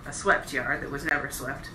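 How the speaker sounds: plain reading voice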